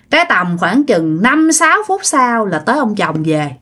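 normal talking voice

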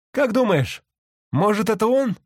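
very high curious voice; tourist voice. A narrator's reading. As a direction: pitch way up for the tourist.

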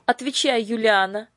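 high, loud frowning demand; stern demand. A narrator's reading. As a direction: press her for an answer. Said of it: "повторила леди Батф и нахмурилась".

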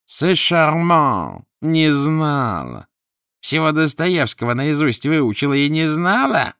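high, loud, slow mocking sneer. A narrator's reading. The ghost mocks the old scholar, in a slow high sneer. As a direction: drag the words out, scoffing at her.

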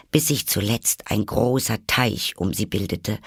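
ordinary delivery, nothing marked

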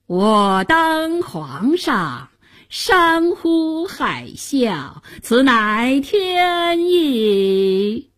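very slow, stretched-out chanting of an imperial edict; self-crowned pretender reading his fake decree; pompous mock proclamation. A narrator's reading. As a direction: intone it grandly, dragging out each syllable high. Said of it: "装模作样地拿出假诏书念起来".

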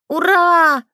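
high, loud, drawn-out hurrah; joyful children's cheer. A narrator's reading. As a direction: cheer like delighted kids. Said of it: "радостно возопила детвора". (радостный детский вопль)

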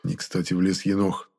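usual speaking voice

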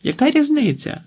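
high-pitched impatient retort; mild shout. A narrator's reading. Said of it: "крикнула Марта".

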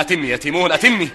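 urgent command. Acted. King urges servant to go on.

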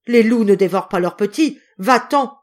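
loud, high-pitched impassioned denunciation; desperate, raging indignation; furious outrage. A narrator's reading. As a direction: defend your child with full fury. Mother rages at husband threatening her child.

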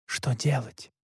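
soft, voiced near-whisper; hushed question. A narrator's reading. Narrator confides in Alexander, hushed and soft. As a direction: ask under your breath. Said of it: "прошептал я".

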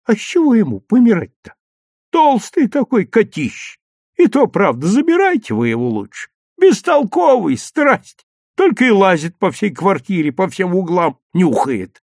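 high, loud dismissive grumble; lively, cross granny character; grumbling old woman. A narrator's reading. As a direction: complain about the cat with exasperation.

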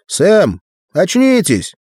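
high, loud wake-up call; rousing call. A narrator's reading. Arnold shaking a muttering Sam awake, loud and high. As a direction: snap him out of it with a loud call.

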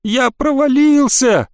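high, slow wail; moaning. A narrator's reading. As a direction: wail it helplessly from below. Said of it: "простонал он".

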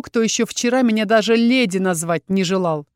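plain reading voice

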